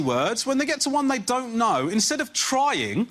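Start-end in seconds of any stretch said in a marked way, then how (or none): none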